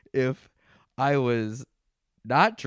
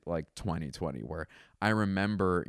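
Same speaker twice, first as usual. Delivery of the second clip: ordinary delivery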